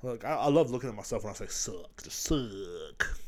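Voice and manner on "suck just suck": low, soft, lingering on the word; joke ASMR. Pretend. Host giving listeners joke ASMR, soft and low. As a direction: draw it out softly into the mic. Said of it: "That's some more A S M R".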